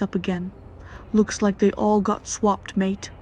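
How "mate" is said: ordinary delivery, nothing marked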